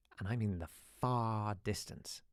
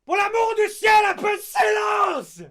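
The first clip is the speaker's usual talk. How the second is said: much higher, much louder shout in French; sudden French shout; 17 dB louder than usual. Retold or imitated. Supply teacher silencing chaos, furious high French shout. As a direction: erupt at a rowdy class. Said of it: "He just suddenly shouted".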